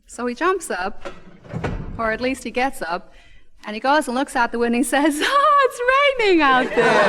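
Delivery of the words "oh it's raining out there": dismayed husband. Retold or imitated.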